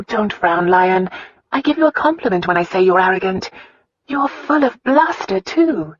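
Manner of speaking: sincere, innocent candour; Christina's voice. Acted.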